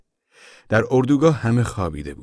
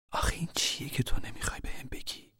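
high pleading whisper; 8 semitones higher, 9 dB quieter than usual. Acted. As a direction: beg him softly to tell. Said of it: "زن التماس کنان گفت".

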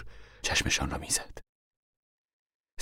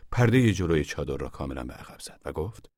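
ordinary delivery

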